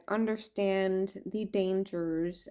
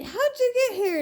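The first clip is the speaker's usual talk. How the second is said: much higher, louder demanding question; mock entity voice; 6 dB louder, faster than usual. Alien being demands how she arrived, high loud. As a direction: squeak it out as the startled being.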